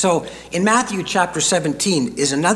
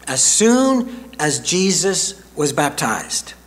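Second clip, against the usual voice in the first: ordinary talk, nothing marked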